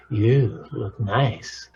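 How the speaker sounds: slow, tender compliment; good loving wolf. Shown as a demonstration. Loving partner giving a compliment, slow and tender. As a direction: say it lovingly, taking your time. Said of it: "good, loving wolf".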